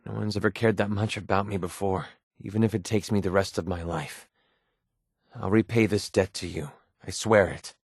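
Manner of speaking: low, sincere vow; heartfelt pledge. Acted. Swearing to repay a partner who cared, low and sincere.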